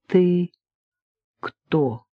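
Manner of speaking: halting, effortful words with a long gap; speaking with difficulty. A narrator's reading. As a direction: push out each word as if barely able to talk. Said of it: "с трудом выговорил Георгий". (с трудом, прерывисто, после паузы)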